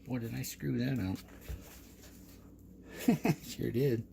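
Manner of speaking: ordinary talk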